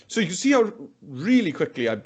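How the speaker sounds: ordinary delivery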